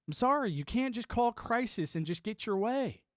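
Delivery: ordinary talk, nothing marked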